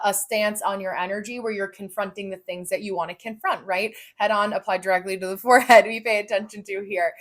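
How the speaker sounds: ordinary talk, nothing marked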